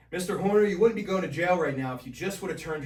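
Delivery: stern judge. Retold or imitated. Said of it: "I came up and".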